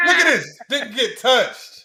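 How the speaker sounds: a high shout on look, then slow on touched; outraged cry. Real, not acted. Friend pointing at the replay, outraged, high shout then slow drawl. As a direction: cry out at the bad call, then drag it.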